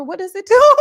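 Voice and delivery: high, loud laughing through the words; real burst of laughter. Real, not acted. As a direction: crack up mid-question. Reading funny comment, cracks up.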